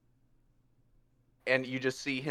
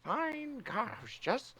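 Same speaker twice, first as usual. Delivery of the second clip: higher but softer grumble; sulky Puss; 9 semitones higher, 8 dB quieter, slower than usual. Acted. Puss sulking at Rivera, higher soft grumble. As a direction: sulk after being told off. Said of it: "She's like".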